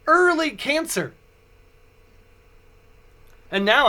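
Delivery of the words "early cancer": doctor's blunt pronouncement. Retold or imitated. Doctor suddenly says in English.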